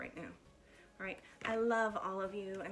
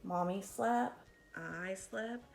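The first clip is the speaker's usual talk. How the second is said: lively, animated picture-book reading; bouncy storytime voice with big swoops; reading to children; slower than usual. Acted. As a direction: read it like storytime for little kids. Teacher reads picture book aloud.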